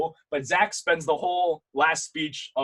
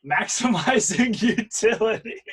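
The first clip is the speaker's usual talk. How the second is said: laughing through the words, high and slow; amused; 7 semitones higher, 4 dB louder, slower than usual. Real, not acted. Coach reads a student's chat answer aloud, amused. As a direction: draw it out high, breaking into laughter.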